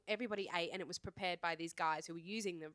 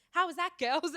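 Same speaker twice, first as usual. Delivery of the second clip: high, loud tour-guide call; cheery tour guide; 9 semitones higher, 8 dB louder than usual. Retold or imitated. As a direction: imitate an oblivious guide calling out brightly. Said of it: "our tr-, tour guide was like".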